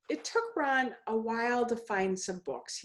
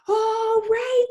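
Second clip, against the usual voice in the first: put-on much higher, louder kid's cheer; excited friend; 14 semitones higher, 7 dB louder than usual. A narrator's reading. Carl shooting baskets greets Ron, high and excited. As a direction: cheer like a kid mid-game.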